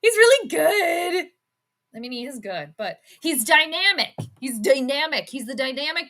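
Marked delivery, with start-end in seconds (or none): 0.0-1.3 mocking commentator. Mocking the analyst's commentary on the clip. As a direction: mimic the analyst, higher and louder. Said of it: "What great commentary".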